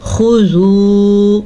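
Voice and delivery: slow melodic tajweed recitation; Quran recitation. Shown as a demonstration. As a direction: draw the vowels out like a Quran reciter. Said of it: "teacher recites the verse to teach".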